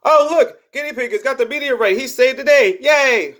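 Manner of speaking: high, fake-cheerful praise; mock cheer. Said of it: "they're just like".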